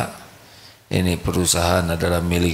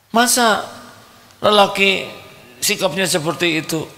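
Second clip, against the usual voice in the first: much higher, nagging wife's voice; scolding wife; 13 semitones higher than usual. Acted. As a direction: scold him like a fed-up wife. (istri mengomel dengan suara tinggi)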